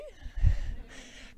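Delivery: breathy laugh fighting tears; emotional laugh. Real, not acted. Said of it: "je suis un peu émue".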